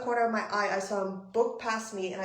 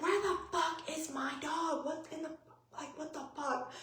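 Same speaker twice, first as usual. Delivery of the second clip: high, softened imitation of a frantic scream; thin high retold wail; mock screaming; 6 semitones higher, 6 dB quieter than usual. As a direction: act out panicked yelling at hushed volume. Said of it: "just screaming and screaming".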